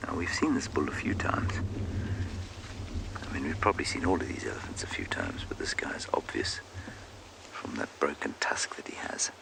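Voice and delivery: soft, half-whispered wildlife commentary; hushed commentary. Real, not acted. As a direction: keep it hushed near the animal. Watching the elephant at close range.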